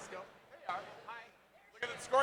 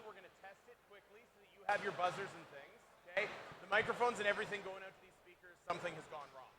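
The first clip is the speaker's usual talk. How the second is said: ordinary talk, nothing marked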